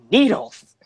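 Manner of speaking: high, loud burst of mock excitement; retold excitement. Vicky pitching her torture idea to Michael, mock excited. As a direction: blurt it out like a proud big reveal. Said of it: "she gets really excited".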